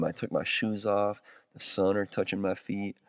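ordinary delivery

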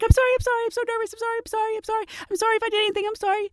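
hammed-up nervous begging; mock scared apology. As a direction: grovel to the officer. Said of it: "I act so scared and nervous and fumbling".

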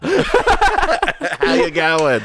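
loud, high, drawn-out Australian; hammed-up Australian greeting; Australian accent. Shown as a demonstration. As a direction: holler it in broad mock Australian. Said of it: "try that in Australian accent".

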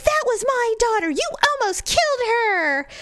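high-pitched, outraged mum impression; mock furious mother. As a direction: accuse the driver, hammed-up and indignant. Angry mother confronts driver, retold.

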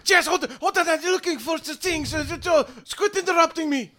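high, frantic, frazzled voice; a harried little man snapping at an intruder; panicked Flizzard. Acted. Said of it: "he looks panicked and worried".

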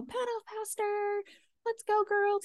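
high-pitched imitation of a class motivator; mock hyped-up. Mimicking an instructor urging on the girls, mock hype. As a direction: cheer the riders on in a high, put-on voice. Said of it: "like, hyped up".

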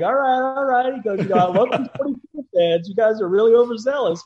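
high, loud mock exasperation; imitating a fed-up star; exasperated celebrity. Acts actor addressing pestering fans.

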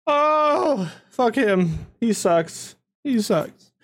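high, tearful cursing; tearful noble. Acted. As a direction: spit it out through tears. Noble curses the attacker.

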